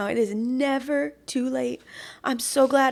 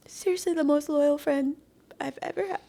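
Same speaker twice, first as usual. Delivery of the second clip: usual speaking voice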